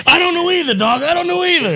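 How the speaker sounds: very high-pitched comic protest; squeaked-up, worked-up impersonation; retold excited comeback. Retold comic's reply to crowd.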